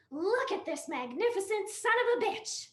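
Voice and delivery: high, loud, excited outburst; proud wild delight; thrilled wife. Acted. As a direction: show off your prize tree. Excited wife shows off tree.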